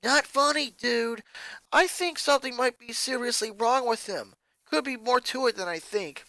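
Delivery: lightly voiced teen rocker, concerned; a hint of a concerned rocker girl; worried rocker. A narrator's reading. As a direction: ask earnestly, a bit uneasy.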